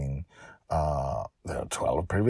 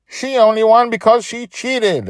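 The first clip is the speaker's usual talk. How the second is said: high, louder accusing whine; whiny kid; 10 dB louder than usual. Acted. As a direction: accuse her like a sore loser. Boy taunts.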